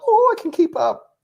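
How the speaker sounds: squeaky high mock cheer; high excited voice. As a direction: pitch it way up, mock eager. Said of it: "I was like".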